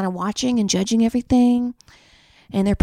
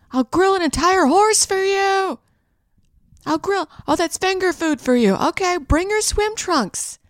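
calling up high; 7 semitones higher than usual. Pretend. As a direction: shout way up to the towering neighbor. Calling up to a giant neighbor.

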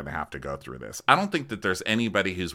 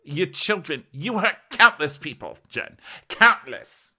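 mock scolding; 11 semitones higher, 5 dB louder than usual. Marge quote, building to loud.

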